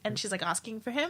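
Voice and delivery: ordinary talk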